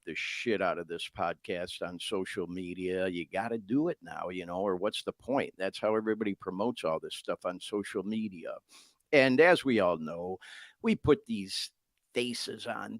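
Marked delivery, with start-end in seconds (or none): none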